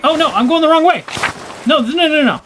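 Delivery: high, loud dismay; flustered alarm. Real, not acted. Lost player realizing a wrong turn, flustered alarm. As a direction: blurt it out in a fluster.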